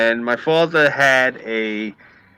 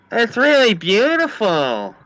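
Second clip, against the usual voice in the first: high, drawn-out admiring female voice; gushing woman impression; 9 semitones higher, slower than usual. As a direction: coo it slowly, way up high. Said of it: "she goes like this to me".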